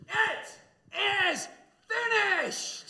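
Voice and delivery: a high, full-throated shout off the microphone. Shown as a demonstration. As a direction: shout it out like a victory cry. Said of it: "Okay, you ready?".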